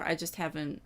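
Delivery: usual speaking voice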